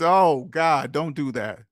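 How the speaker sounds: normal talking voice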